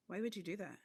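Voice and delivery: understated disapproval, dropping at the end; unintentionally judgmental. Retold or imitated. Face betraying the speaker, quietly judgmental question. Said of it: "my face does the same thing".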